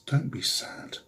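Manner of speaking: soft, hushed comfort to a crying follower; gentle plea. Acted. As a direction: whisper it tenderly.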